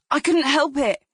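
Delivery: high, loud defensive cry; panicked plea. Acted. Taxi driver to onlookers after hitting child, loud panicked plea. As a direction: plead your innocence to the crowd.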